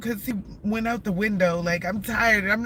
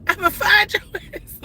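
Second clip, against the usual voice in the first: much higher and louder, laughing mid-threat; stern mom to son; 10 semitones higher, 7 dB louder than usual. Retold or imitated. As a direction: scold your son half-laughing, mock fierce. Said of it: "This how I used to be with my son".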